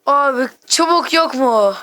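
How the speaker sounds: whiny, slowed-down complaint about no sticks; slow, drawn-out plea. Real, not acted. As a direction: drag the words out in dismay. Desperately looking for sticks.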